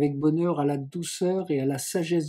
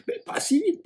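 much higher "vite" cried out breathlessly; breathless protest. Acted. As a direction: Juliette hurried along, higher breathless protest. Je suis tout essoufflé.

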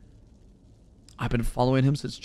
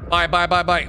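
ordinary talk

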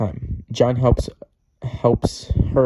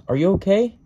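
high, loud question rising at the end; acted yell, pitched up; worried mock yell; 9 semitones higher, 7 dB louder than usual. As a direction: call upstairs to check on someone. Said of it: "hears a loud bang from upstairs and yells".

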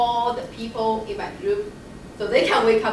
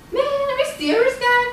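exasperated disbelief; 6 dB louder than usual. Retold or imitated. Friend woken up grumbling to God.

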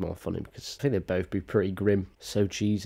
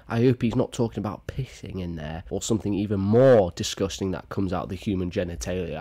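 ordinary delivery

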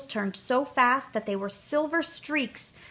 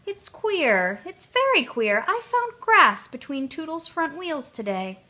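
puzzled chief oiler; 6 semitones higher, 4 dB louder than usual. A narrator's reading. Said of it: "he said".

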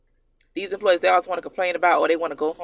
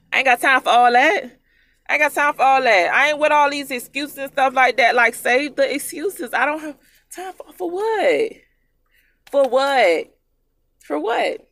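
louder, higher, exasperated; fed-up rant; 7 semitones higher, 7 dB louder than usual. Real, not acted. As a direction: brush off every excuse hard. Ranting about employees' excuses.

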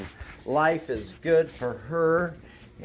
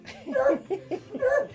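high laugh; 3 dB quieter than usual. Real, not acted. Laughs at time change joke.

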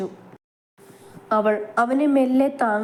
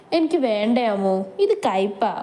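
plain reading voice

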